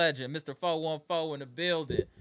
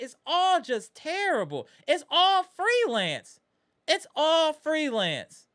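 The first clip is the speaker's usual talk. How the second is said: much higher, louder, slow and drawn out; exasperated outburst; 10 semitones higher, 5 dB louder, slower than usual. Real, not acted. Fed-up fan venting to chat about freelance play, exasperated. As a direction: stretch each word in disbelief.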